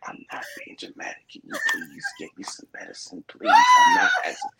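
faint, high, strained whisper that bursts out at the end; sick coughing voice. Retold or imitated. Sick man insisting to girlfriend he needs medicine, strained sick voice. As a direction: plead weakly, then crack into a strained cry. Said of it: "I said it in my coughing voice".

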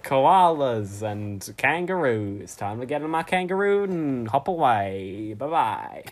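slow, drawn-out fake Australian farewell; hammed-up Aussie accent, stretching the goodbye; Mister Aussie. Mister Aussie hopping off on his kangaroo, overdone Australian accent. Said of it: "bad impression of an Australian person called Mister Aussie".

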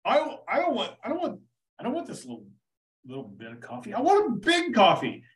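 mock American soldier insisting on a big coffee; pushy American tourist. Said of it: "the Americans were like".

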